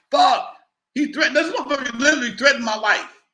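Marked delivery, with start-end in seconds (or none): 0.1-0.6 higher, louder fuck; shocked curse. Retold or imitated. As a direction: blurt it in alarm. Reacting to a death threat.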